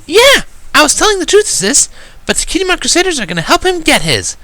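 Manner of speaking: put-on very high, insistent little-girl voice; eager filly voice. Filly insists to her sister, high and eager. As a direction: plead earnestly to a big sister. Narrator voices Sweetie Belle.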